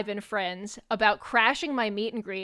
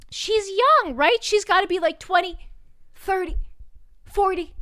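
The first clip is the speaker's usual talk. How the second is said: high-pitched, bewildered imitation; mock-baffled fan voice, much higher; incredulous guessing; 8 semitones higher, 3 dB louder than usual. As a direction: climb high, unsure, testing each guess. Retold guy doubts, guessing her age.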